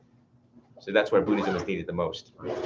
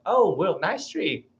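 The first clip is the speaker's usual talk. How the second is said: higher, idle voice of an untroubled animal; carefree animal; 7 semitones higher, slower than usual. Retold or imitated. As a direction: lightly voice a contented pet noticing something. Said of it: "they just like".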